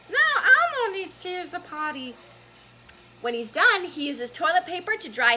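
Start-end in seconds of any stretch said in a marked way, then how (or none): 0.1-2.1 put-on high, squeaky children's puppet voice; Elmo voice. Reading Elmo's potty line to kids, high Elmo voice. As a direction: pitch it way up like a little puppet character. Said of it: "I'm gonna try the Elmo voice".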